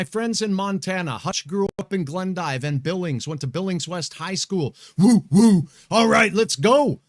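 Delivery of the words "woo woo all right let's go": excited cheer. Real, not acted. Cheering for his old high school.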